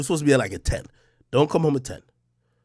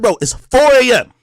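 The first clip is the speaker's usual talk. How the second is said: angry; 5 semitones higher, 8 dB louder than usual. Retold or imitated. Angry call to boss.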